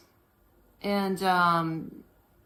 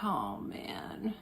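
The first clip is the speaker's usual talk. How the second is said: whisper; 10 dB quieter than usual. Retold or imitated. Inner thought, whispered.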